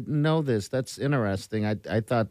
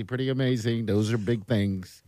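ordinary talk, nothing marked

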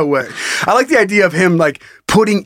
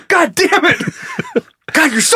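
mock breakdown; 12 semitones higher than usual. Robber has a breakdown.

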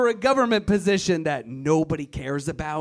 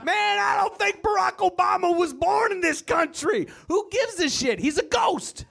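much higher, shouting through laughter; shouted conspiracy rant; 11 semitones higher than usual. Retold or imitated. Comic acting out a conspiracy theorist arguing, high laughing shout. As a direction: yell it like a true believer. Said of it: "conspiracy theorist and reply".

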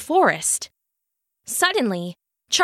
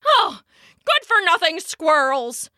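grumbled; 4 semitones higher, 3 dB louder than usual. A narrator's reading. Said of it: "Charlie grumbled to himself".

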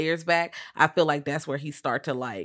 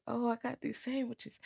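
much softer, timid offering; meek Norman; 7 semitones higher, 11 dB quieter than usual. Retold or imitated. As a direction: sheepishly hand over the sandwiches.

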